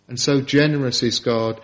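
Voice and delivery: ordinary talk, nothing marked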